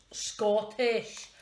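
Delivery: attempted Scottish accent, leaning hard on the word. Shown as a demonstration. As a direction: have a shaky go at a Scottish accent. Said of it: "not very good at doing the accent".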